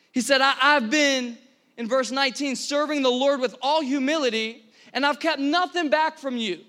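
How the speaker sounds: fervent pulpit declaration; full-force preaching. Real, not acted. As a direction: preach it out loud and high, driving every phrase.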